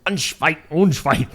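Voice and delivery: a hammed-up German accent; mock German accent. Host slipping into German mode for a Berlin story, mock German accent. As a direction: mangle the name in a put-on German accent. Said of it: "let me get into German mode".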